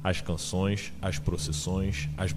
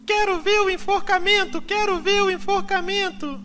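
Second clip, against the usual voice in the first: voz de menina muito aguda e mais alta; criança birrenta exigindo em cantilena estridente; garota cantarolando; 6 dB louder than usual. A narrator's reading. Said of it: "cantarolou a garota". (menina cantarolando em voz bem aguda)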